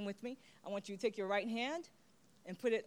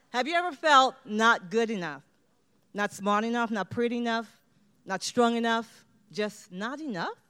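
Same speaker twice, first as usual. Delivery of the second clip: louder, higher rhetorical build; impassioned questions; 4 semitones higher, 7 dB louder than usual. Real, not acted. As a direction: press each question home.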